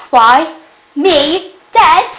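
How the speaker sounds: high, loud, incredulous questions; can't believe she was thought dead; shocked disbelief. A narrator's reading. Carrie stunned to hear she was thought dead, shocked.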